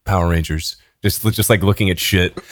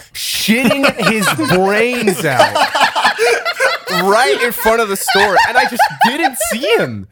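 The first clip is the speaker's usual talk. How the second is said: higher, laughing through the story; laughing while telling; 10 semitones higher than usual. Real, not acted. Retelling the homeless man story, laughing throughout. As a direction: giggle through the words. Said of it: "Man, can't believe they went out of business".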